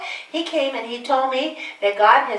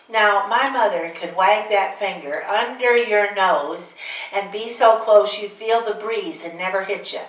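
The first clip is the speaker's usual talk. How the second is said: ordinary talk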